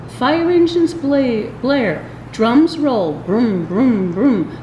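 ordinary delivery, nothing marked

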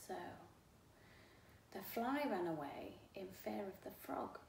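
ordinary delivery, nothing marked

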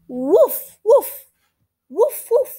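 loud high yappy puppy woof; puppy barks. Acted. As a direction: bark like an eager little puppy. Voicing the puppy Biscuit.

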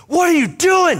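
high alarmed yell; startled shout. Retold or imitated. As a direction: shout back in panic at the stranger. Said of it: "they turn around and they say".

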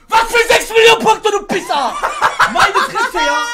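very loud, high-pitched outburst; outraged yell. Real, not acted. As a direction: explode in disbelief at the score. Outburst at the points score.

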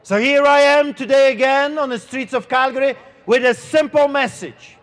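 ordinary talk

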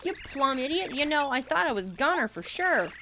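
loud, high, scrappy backwoods twang; ornery hillbilly critter. Acted. Bug in the whale's belly challenging Mario, scrappy twang. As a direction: pick a fight, all bluster.

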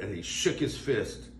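ordinary delivery

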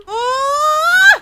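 high, loud cry of alarm; sharp frightened "ah"; startled shout. Real, not acted. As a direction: yelp as the hit lands. Getting attacked by the boss.

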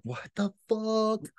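high, slow, incredulous; baffled. Retold or imitated. Reacting to trolls' comma complaint, high slow disbelief. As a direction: let the disbelief hang. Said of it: "And it's just like".

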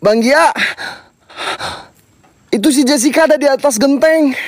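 normal talking voice

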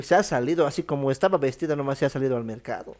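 ordinary talk, nothing marked

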